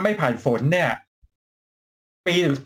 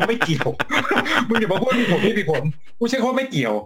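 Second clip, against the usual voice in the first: emphatic rebuttal broken up by laughing; laughing insistence; 3 semitones higher than usual. Real, not acted. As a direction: insist through real laughter. Laughing rebuttal of hedged answer. (ยืนกรานทั้งที่หัวเราะ)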